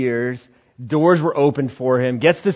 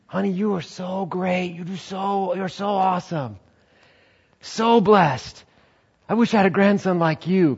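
higher, cooing grandma voice; doting grandma; 6 semitones higher than usual. Acted. Church grandmas fawning over the young pastor, cooing. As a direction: fuss over him like a sweet grandma.

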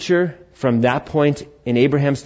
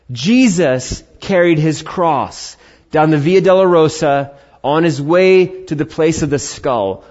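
ordinary talk

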